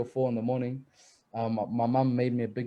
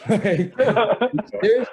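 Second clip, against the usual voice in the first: high, loud, laughing disbelief; shocked disbelief; 10 semitones higher, 10 dB louder, much slower than usual. Retold or imitated. Player retelling the coach's news, high laughing disbelief. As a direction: squeal it out like you can't believe it.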